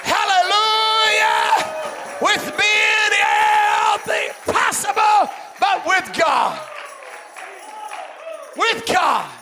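high, loud shouted exhortation; impassioned full-force preaching. Real, not acted. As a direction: drive it to a shouting, rejoicing peak.

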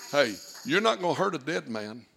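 usual speaking voice

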